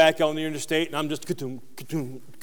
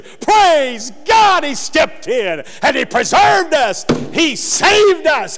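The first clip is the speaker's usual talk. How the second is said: higher, much louder rejoicing; louder, higher shout of praise; joyful praise shout; 10 semitones higher, 9 dB louder, slower than usual. Acted. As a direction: cry out thanks like in church. Voicing church testimony, shouted.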